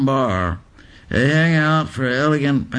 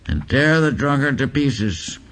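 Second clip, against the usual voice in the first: ordinary delivery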